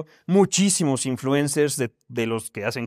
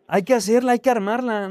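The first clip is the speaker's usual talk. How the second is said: excited quote; 7 semitones higher, 4 dB louder than usual. Retold or imitated. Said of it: "Pero dije".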